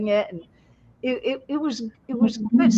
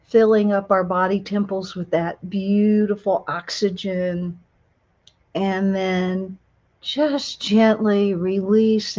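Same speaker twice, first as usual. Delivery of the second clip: guided meditation; 4 semitones lower than usual. Acted. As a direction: ease them into calm, unhurried.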